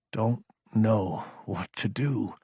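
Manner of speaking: "don't know what to do" slowly, a gap after each word; emphasizing each word. A narrator's reading. Lieutenant confessing helplessness, "don't know what to do" word by word. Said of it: "he emphasized each word".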